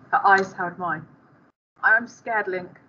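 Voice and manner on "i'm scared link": usual speaking voice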